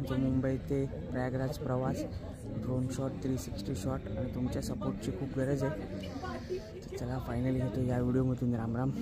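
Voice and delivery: soft late-night whisper. Real, not acted. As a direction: whisper close to the mic, barely voiced. Don't make noise, all sleeping.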